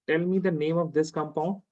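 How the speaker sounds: ordinary talk, nothing marked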